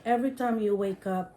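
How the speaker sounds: ordinary talk, nothing marked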